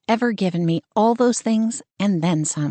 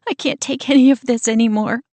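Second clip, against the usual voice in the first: higher, louder and breaking apart; voice cracking; 6 semitones higher, 5 dB louder than usual. Acted. Sister on the phone, voice cracking in despair. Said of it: "Her voice cracks again".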